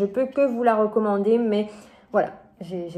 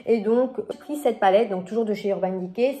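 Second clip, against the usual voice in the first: usual speaking voice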